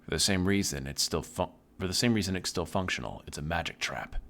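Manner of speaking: ordinary talk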